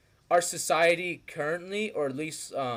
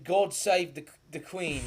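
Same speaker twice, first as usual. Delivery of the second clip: put-on lightly done posh British accent, slower; mock British accent; 2 semitones higher, slower than usual. Mocking how the English talk, light British accent. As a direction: sneer like a stuffy Englishman, a hint of British. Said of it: "England, they talk British".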